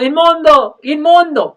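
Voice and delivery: loud, high cry of unclean; leper's shout. Retold or imitated. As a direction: shout it to warn people away. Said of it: "iban diciendo". (grito fuerte de leproso)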